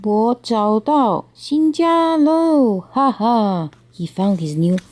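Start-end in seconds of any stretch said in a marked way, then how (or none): 0.0-3.7 high, slow, gleeful turtle cry; happy exclaim. A narrator's reading. Turtle reaches the creek, high slow happy cry. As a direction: sing out the joy of a new home. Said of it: "高兴地说".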